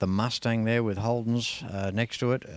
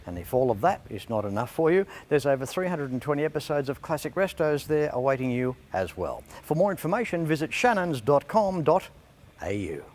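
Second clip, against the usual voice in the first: upbeat radio sponsor read, a bit higher; 4 semitones higher than usual. Acted. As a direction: sell it like a live radio ad. Host reads a sponsor ad.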